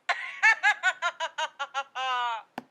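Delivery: soft laugh after the punchline; soft amused laugh. Acted. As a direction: chuckle at your own joke.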